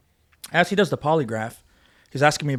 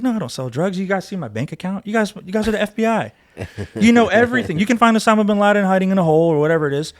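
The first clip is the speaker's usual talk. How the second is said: retold protest, higher-pitched and insistent; indignant retort; 6 semitones higher, 4 dB louder than usual. Officer protesting to the FBI that they know everything, indignant. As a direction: push back hard, pitch riding high.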